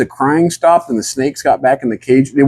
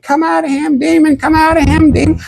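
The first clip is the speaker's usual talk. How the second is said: high, chanted exorcist cries; mock exorcism. Pastor's wife casting out a demon, mock exorcism cry.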